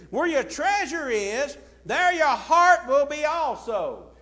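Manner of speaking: booming pulpit repetition; emphatic scripture quote. Shown as a demonstration. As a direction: proclaim the verse to the room. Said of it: "I want you to notice what he said".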